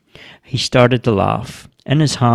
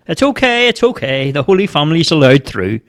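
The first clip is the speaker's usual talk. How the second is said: lightly joking, higher-pitched reassurance; amused reply; 6 semitones higher than usual. A narrator's reading. Bobo joking with the startled man, amused. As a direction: play along with the joke. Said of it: "saw the funny side of it".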